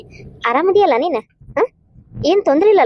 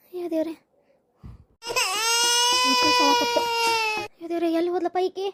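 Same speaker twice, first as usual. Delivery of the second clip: soft, fretful near-whimper; hushed worried lament to herself; anxious muttering; 4 semitones higher, 8 dB quieter than usual. Acted. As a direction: mutter it quietly, close to tears. Mother searches for missing daughter.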